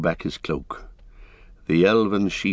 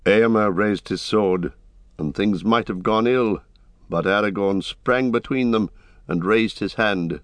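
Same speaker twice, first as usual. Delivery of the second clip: ordinary delivery, nothing marked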